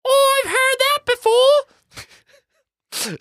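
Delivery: very high, loud, slow ghost squawk; Tommyknocker. A narrator's reading. Tommyknocker brushes off Eddie's excuse, high drawn-out squawk. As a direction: stretch it out in a piercing little goblin voice. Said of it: "said Tommy Knocker dryly".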